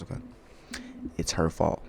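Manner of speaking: normal talking voice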